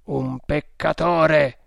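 slow, loud, solemn denunciation; accusing cult leader. A narrator's reading. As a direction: pronounce it like a judge of the faithful. Cult leader accuses before followers.

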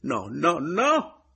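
loud cries climbing high; crying out. A narrator's reading. Adriana rejecting the apology, crying out. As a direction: cry out to stop it. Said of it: "gridò Adriana".

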